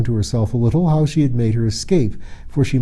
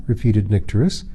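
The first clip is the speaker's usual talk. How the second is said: ordinary delivery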